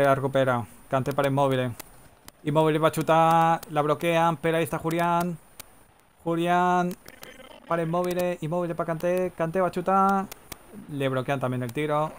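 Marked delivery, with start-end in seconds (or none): 2.4-10.3 high, sustained monotone commentary; tense play-by-play. Pretend. Commentator following passes towards a shot, urgent running call. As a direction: keep the pitch up as the attack builds.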